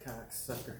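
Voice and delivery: ordinary talk, nothing marked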